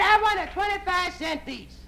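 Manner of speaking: very high-pitched lady's call; woman yelling down. Acted. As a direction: holler an order down to the vendor. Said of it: "She yells down to the guy".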